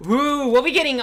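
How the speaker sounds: bright rising burst of excitement; thrilled about the news, voice jumping up; excited. Real, not acted. News of new story quests.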